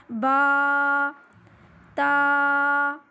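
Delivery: با and تا each held long on one steady note; letter articulation demo. Shown as a demonstration. Teacher showing students where the letter comes from, letter articulation demo. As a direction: draw out با, then تا, loud and level. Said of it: "آپ کو پتہ چل جائے گا کہ با کہاں سے نکل رہا ہے".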